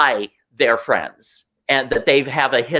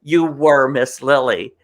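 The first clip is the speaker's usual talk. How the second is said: ordinary delivery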